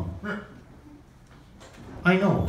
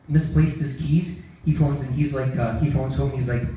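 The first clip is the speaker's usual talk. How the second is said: normal talking voice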